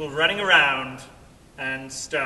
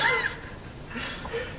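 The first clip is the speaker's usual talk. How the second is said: breathy high-pitched laugh; laughing while telling the story; 6 dB quieter than usual. Retold or imitated. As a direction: burst into laughter.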